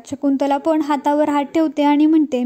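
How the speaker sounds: ordinary delivery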